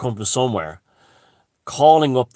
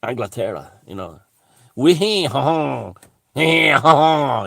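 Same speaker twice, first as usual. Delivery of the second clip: hammed-up French accent, chuckling at the end; mock French official. French official waving migrants on, mock French accent with laugh. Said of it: "The French have been doing the same".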